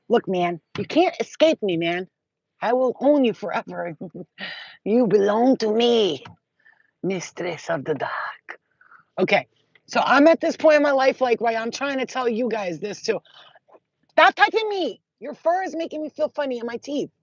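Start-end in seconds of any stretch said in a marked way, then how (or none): 2.6-8.6 mock villain. Teasing her cat as a dark overlord, slow hammy menace. 14.1-17.1 whiny complaint. Real, not acted. Cat touching her.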